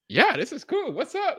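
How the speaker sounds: high, upbeat hello to a fan; high cheerful greeting; friendly greeting. Retold or imitated. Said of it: "I'm like".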